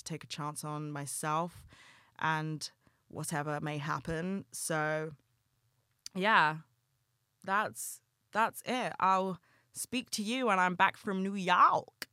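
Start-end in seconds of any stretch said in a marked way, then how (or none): 6.1-12.1 a self-admitted bad New York accent, pitched up and louder; failed New York accent. Podcaster saying goodbye to listeners, trying a New York accent. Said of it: "That was awful. I can't do a New York accent".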